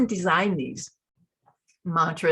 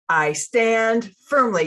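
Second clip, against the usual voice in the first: slow, louder, higher, firm chant; firm declaration; 4 semitones higher, 7 dB louder, slower than usual. Shown as a demonstration. Coach shifting gears back to a firm, loud declaration. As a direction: stand tall and declare it. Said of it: "just like a shifting of gears".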